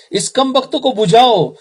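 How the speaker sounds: high-pitched acted call to put out a fire; mock urgent call. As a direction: call out like a bystander raising the alarm. Acted crowd alarmed at fire.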